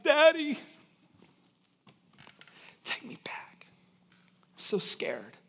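put-on scared child. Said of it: "She was just shaking and crying".

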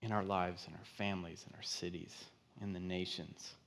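quiet, low, earnest list; hushed appeal. Real, not acted. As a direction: let it sink in, phrase by phrase. Hushed appeal closing the sermon.

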